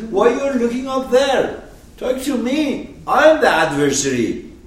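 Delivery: loud, high-pitched confrontation; adversary's shouted demand. Acted. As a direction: demand his attention at full voice. Acted adversary demanding attention.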